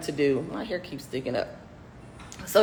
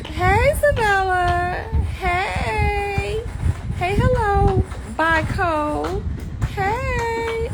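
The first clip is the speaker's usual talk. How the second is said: excited calling; 13 semitones higher, much slower than usual. Real, not acted. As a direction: coo and call to the animals. Calling out to her pets.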